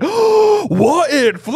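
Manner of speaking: very high, loud amazed cry; gleeful disbelief, hammed up; overjoyed. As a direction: burst out in squeaky delight. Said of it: "he's so happy".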